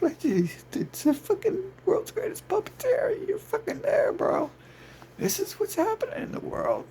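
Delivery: very high, taunting boast; mock puppeteer boast. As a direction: taunt like a gloating puppet master. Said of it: "They're like".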